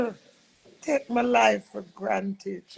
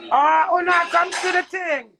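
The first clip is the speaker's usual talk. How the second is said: loud startled cry, higher; loud, higher real surprise when someone calls her; surprised; 7 semitones higher, 8 dB louder than usual. Real, not acted. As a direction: blurt it out, caught off guard.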